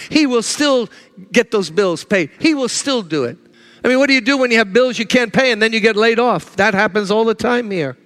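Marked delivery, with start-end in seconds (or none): none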